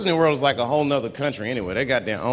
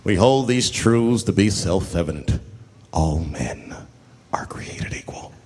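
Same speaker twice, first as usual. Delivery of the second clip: slow, lower grand speech fading to a hush; solemn oration; 8 semitones lower, slower than usual. Retold or imitated. Washington reciting the Declaration, lightly done solemn oration. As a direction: orate it like a founding father. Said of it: "he wrote the Declaration".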